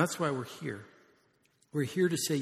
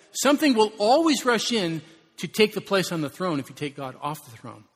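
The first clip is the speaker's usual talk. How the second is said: louder and higher, driving it home; forceful warning; 5 semitones higher, 9 dB louder than usual. Real, not acted. Pastor warning the congregation, forceful preaching. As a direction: warn them with force.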